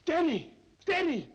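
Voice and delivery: high, loud, urgent shouts for Denny; frantic cry for help; alarmed calling. Acted. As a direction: call him over in a panic.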